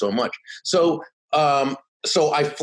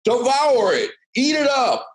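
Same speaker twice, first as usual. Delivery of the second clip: Charlie Jones imitation; 13 semitones higher, slower than usual. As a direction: play the teasing speaker quizzing the crowd. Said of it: "a Charlie Jones imitation".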